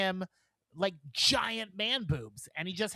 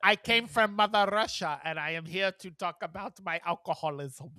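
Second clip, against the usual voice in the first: heavy put-on Russian accent; 4 dB louder than usual. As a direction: play a comic Russian immigrant. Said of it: "she hates my accent".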